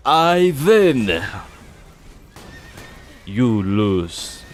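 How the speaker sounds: put-on loud gloating Russian accent; mock Russian accent. Russian Ivan winning at Monopoly, loud gloating accent. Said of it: "for the accent".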